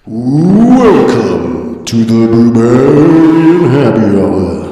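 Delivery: heavy echo, slow announcer boom; drawn out through a big echo; echo chamber. Acted. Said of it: "that's really echoey".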